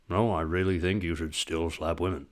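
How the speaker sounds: put-on low, mumbled old Scottish man; lightly voiced Sean Connery; Sean Connery impression. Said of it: "I can't even do an impression of him".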